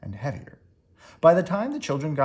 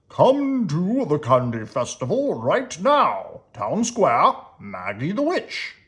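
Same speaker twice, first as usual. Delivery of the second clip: louder, slow proclamation; announcing a sign; 3 semitones higher, 4 dB louder, slower than usual. A narrator's reading. Reading the sign on the lawn, announced loudly. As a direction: call it out like a festival poster.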